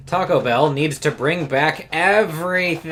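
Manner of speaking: shouty all-caps chat message; all caps reading. Retold or imitated. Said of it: "says in all caps".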